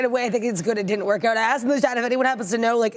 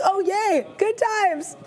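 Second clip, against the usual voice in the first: sarcastic cheer; 7 semitones higher, much slower than usual. Retold or imitated. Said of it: "I'm like".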